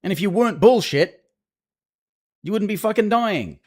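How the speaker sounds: loud angry rant at the game; swearing tirade. Real, not acted. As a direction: shout the complaints out in rage. Ranting loudly at the game.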